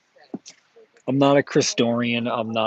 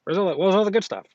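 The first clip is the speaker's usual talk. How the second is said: higher, eager mock collector voice; imitating the comic guy; 9 semitones higher, much faster than usual. Vendor mocking the collector who always asks for good stuff. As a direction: ape the pushy buyer, fast and high. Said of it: "He's like".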